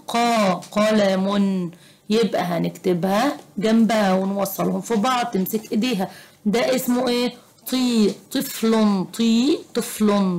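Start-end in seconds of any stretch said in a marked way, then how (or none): 0.1-1.7 قا held long, then قلم slowly with a heavy q; drawn-out Q syllable. Shown as a demonstration. Teacher answering her own question, slow heavy q in قلم. 7.7-10.4 طي held long, then طفل with a heavy ط; emphatic T. Shown as a demonstration. Teacher showing which letter is used, heavy ط in طفل. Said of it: "بالطاء، مش بالتاء".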